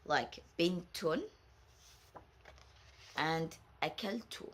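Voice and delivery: "bintun" and "akaltu" said very slowly, ta clear; slow model words. Shown as a demonstration. Teacher slowly modelling ta al-maftuha words. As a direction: drag out "bintun" and "akaltu" for learners. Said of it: "A ta al-maftuha".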